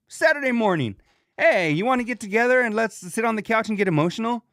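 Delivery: high-pitched, eager pretend invite; hammed-up proposal, pitched well up; mock invitation. Mock invitation to get emotional.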